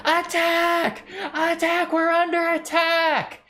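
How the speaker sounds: high panicked yell, calling the camp to arms; screaming alarm. Acted. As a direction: shriek it like you just woke to a sword. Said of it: "he screams bloody murder".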